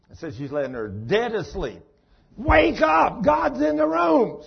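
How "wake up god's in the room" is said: very high, very loud frantic scream. Retold or imitated. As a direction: scream it in full panic, slower and stretched. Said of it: "Screaming, hitting her in the side".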